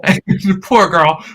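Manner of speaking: ordinary talk, nothing marked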